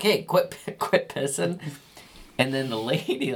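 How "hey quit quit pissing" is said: scolding the dog. Retold or imitated.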